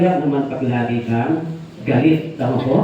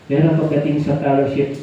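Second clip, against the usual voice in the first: usual speaking voice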